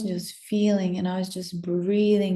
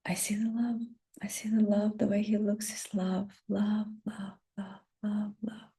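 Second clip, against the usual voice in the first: soft whisper fading out on love; tender whisper, softer and softer; whispered awe; 7 dB quieter than usual. Real, not acted. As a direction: let it dissolve into a whisper. Awed memory of his gaze.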